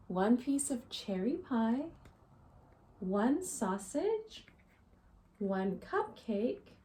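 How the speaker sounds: ordinary delivery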